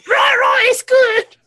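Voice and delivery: squealing high and loud; high shout. Real, not acted. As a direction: yelp it out excited.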